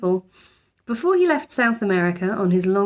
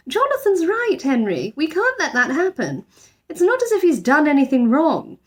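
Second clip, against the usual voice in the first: higher, earnest mother defending the bear; mother siding with her son, higher voice; motherly voice; 8 semitones higher, 3 dB louder than usual. A narrator's reading. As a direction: stand up for him, a little louder. Voices Mrs Brown.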